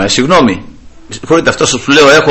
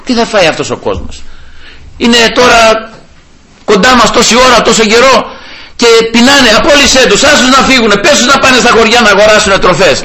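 higher, anxious pleading to the Lord; worried disciples; 7 semitones higher than usual. Retold or imitated. Disciples urging Christ to dismiss the hungry crowd, higher and anxious. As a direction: voice the fretting disciples begging Him to send the crowd away. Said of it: "οι μαθητές ανησυχήσανε".

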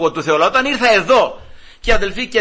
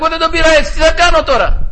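complaining wife; 9 semitones higher, 5 dB louder than usual. Retold or imitated. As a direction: play the wife pleading to the judge, pitched way up.

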